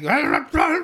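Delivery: high, loud retching noises; mock vomiting. Boy handed a note by his crush, mock vomiting. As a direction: imitate being sick again and again. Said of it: "Still fucking throwing up".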